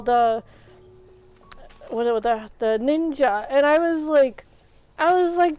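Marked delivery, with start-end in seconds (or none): none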